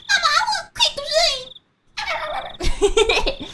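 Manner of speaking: put-on very high, whiny-kid Southern squeal; mock a little girl begging Mama. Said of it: "overweight little girl with the golden curls".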